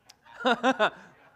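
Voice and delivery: high, loud burst of laughter; big high-pitched laugh. Real, not acted. Reacting to church laughing.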